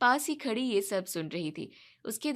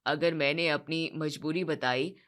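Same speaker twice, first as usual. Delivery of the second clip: father explaining to his son why he hides the truth; resigned father; 8 semitones lower than usual. Acted.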